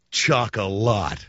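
"Chocolat" stretched out very slowly; mocking drawl. Real, not acted. Comedian scorning the Oscar favourite Chocolat, slow mocking drawl. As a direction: draw out each syllable of "Chocolat" in disdain. Mocking the film's French title.

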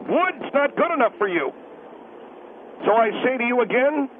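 ordinary talk, nothing marked

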